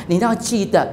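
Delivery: ordinary talk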